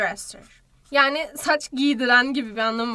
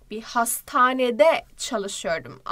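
slow translation; much slower than usual. Shown as a demonstration. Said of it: "slow translation for pupils".

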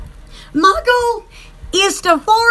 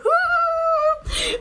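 soft laugh; 8 semitones higher, 5 dB quieter than usual. Real, not acted. Laughing in the live chat.

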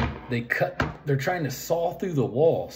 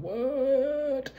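drawn-out, high-pitched "what?"; mock disbelief; 13 semitones higher than usual. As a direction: let it soar up high in exaggerated bafflement. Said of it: "my mind's just going".

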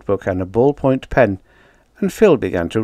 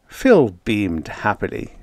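ordinary delivery, nothing marked

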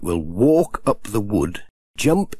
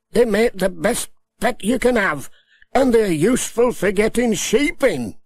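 raised-pitch Alf, friendly advice; lightly high-voiced Alf; Alf's voice; 12 semitones higher than usual.

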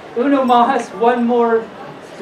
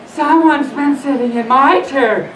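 slow, slightly raised mother bear; higher, drawn-out storybook mama bear; middle-sized mother bear voice; 6 semitones higher, slower than usual. Acted. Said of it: "in her middle-sized voice".

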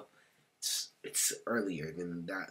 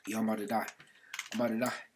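mock panic; 4 semitones higher, 5 dB louder, much faster than usual. Pretend drowning in the game.

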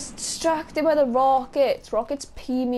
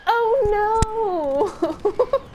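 high-pitched mock wail; hammed-up cry of dismay, drawn out; replayed dismay; 10 semitones higher, 3 dB louder than usual. Player replaying their own reaction, high mock dismay. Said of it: "I was like".

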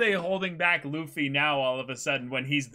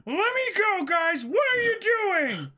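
much higher, straining yell to break free; struggling shout; 13 semitones higher, 3 dB louder than usual. Acted. Luffy yells at his crew to let him go. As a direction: fight off the hands holding you.

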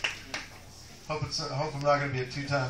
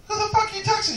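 much higher, indignant question; outraged squeal; 3 dB louder than usual. Retold or imitated. Comic catching his lady texting in bed, high outraged squeal. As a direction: shriek the accusation in disbelief.

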